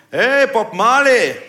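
laut und langsam gedehnt; rufender Spötter. Retold or imitated. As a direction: ruf es ihm über die Straße. Gerufene Spitznamen nachgemacht. (Spötter ruft laut gedehnt)

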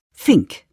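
"Fink" as one short word, no L after the F; correcting to FINK. Retold or imitated. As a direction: put in "Fink" with a falling, corrective tone. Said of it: "Es heißt Fink". („Fink“ korrigierend, ohne L)

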